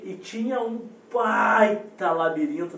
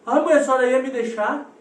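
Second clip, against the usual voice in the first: higher, louder mock-child protest; indignant child; 6 semitones higher, 7 dB louder than usual. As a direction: imitate a kid appalled at nearly being left.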